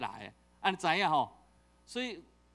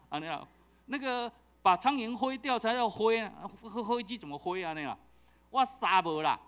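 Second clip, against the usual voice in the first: usual speaking voice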